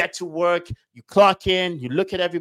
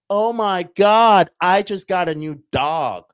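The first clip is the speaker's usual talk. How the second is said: high, loud, drawn-out excitement; hammed-up social media brag; mock gushing; 5 semitones higher, 6 dB louder, much slower than usual. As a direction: gush slowly as if it were huge news. Acts out a gushing social post.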